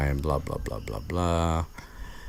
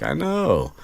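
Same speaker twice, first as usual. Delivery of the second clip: much higher knowing Lockhart voice; mock Lockhart; 11 semitones higher than usual. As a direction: toss it off as a self-satisfied Lockhart. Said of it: "he's all like".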